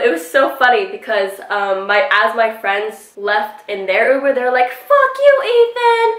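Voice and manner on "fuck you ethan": very high, loud mock holler; shouted parting insult. Friends yell at the man from their Uber, high mock shout. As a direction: imitate friends yelling from a departing car.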